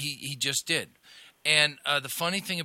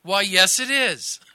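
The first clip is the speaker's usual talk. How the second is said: mom's sweet reply; 5 semitones higher, 10 dB louder, slower than usual. Retold or imitated. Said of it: "My mom goes".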